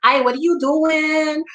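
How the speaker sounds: put-on New York Spanish accent. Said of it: "a New York Spanish accent".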